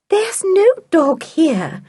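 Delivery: high, slow, timid denial. A narrator's reading. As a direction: say it nervously, hesitant. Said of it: "coming timidly up the stairs".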